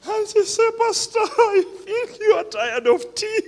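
amused retold offer; laughing. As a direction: laugh through her words. Quoting sister, laughing.

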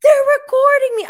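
high, loud, fast panicked cry; panicked squeal. Retold or imitated. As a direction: blurt it in sudden panic. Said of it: "she goes".

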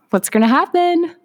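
high, rising worried question; mock worry. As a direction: lightly act out an anxious thought, pitch climbing. Acting out a worried inner thought.

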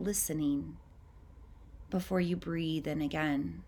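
calm, unhurried meditation voice with long pauses; guided meditation. Acted. Guiding listeners to tune into their breath, calm meditative voice. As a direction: ease the listener into noticing each breath.

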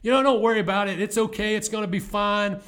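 upbeat coach pep talk. Retold or imitated. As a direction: pump up the losing team, bright and loud. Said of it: "If I go in and be positive".

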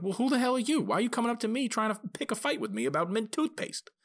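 a stranger snaps back, offended. Retold or imitated. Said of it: "They'd be like".

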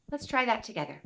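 usual speaking voice

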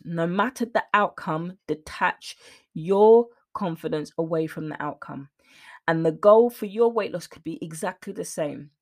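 ordinary talk

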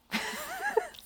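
quick high giggle; a nervous little laugh. Real, not acted. Laughing at own fear.

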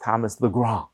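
put-on name said with a light French accent. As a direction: give the name a French lilt, a little hammed. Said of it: "I'm doing my best French accent".

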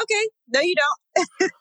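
high, wry correction to herself; realizing there are no hours left; caught off guard. Retold or imitated. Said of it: "in my mind, I was like".

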